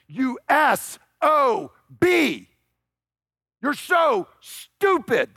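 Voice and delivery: furious king. Retold or imitated. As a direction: spit each word at the son like an enraged father. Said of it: "But what he is saying is".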